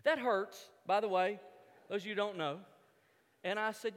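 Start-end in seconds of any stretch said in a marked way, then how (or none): none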